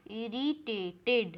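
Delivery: "इरिटेटेड" slowly, syllable by syllable; "id" ending. Shown as a demonstration. Teacher tests the word for the class, "इरिटेटेड" slow with an id ending. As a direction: stretch out "इरिटेटेड" to show the last -ted. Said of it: "इस पे आईडी का आवाज़ आ रहा है".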